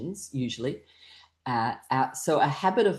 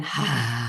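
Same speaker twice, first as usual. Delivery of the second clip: drawn-out "ha" breaking into light laughter; breathing-exercise laugh; long exhaled ha; 4 semitones lower than usual. Shown as a demonstration. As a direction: let the out-breath run on into a trail of small ha-has. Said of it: "longer out breath than in breath".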